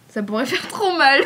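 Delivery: real amused laughter on the words; laughing. Real, not acted. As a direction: laugh through your own joke. Laughs at own risqué joke.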